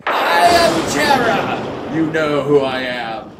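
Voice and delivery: mock spooky intimidation; spooky possessed voice. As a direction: play a hammy ghostly queen, drawing out each word. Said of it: "she's saying spooky, cryptic shit".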